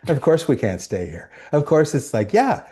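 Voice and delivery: weary of-course shrug, pitch climbing; resigned shrug. Retold or imitated. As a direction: shrug it off like nothing could be different. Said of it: "Chief's reaction would be".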